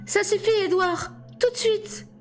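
very high-pitched, urgent command; barked order. A narrator's reading. Alice orders Edward to stop, high-pitched bark. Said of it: "les pensées qu'Alice aboyait".

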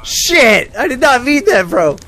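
loud, high panicked shouting; panicked yelling. Real, not acted. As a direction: yell in horror at your mistake. Panicked after grenade mishap.

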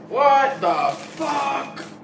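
high, drawn-out shout of shock. Real, not acted. Reviewer staring at a strange radioactive burger, shocked shout.

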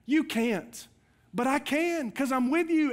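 a lightly voiced God reassuring Joshua; voicing God. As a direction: speak as God promising to stay near.

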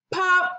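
high, sharp pop; pop sound. A narrator's reading. Narrator imitating a popping button, high pop.

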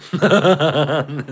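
loud, high burst of laughter; laughing. Real, not acted. Telling how they took it down, laughing. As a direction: laugh hard at your own story.

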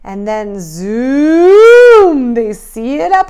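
big rising zoom, slow and loud; excited build. A narrator's reading. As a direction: stretch the zoom with rising thrill. Climax of zoom chant.

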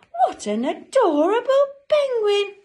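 very high, loud, hammed-up gush; gushing admiration. Great big man adoring the penguin, loud high gushing. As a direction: squeal with storybook adoration.

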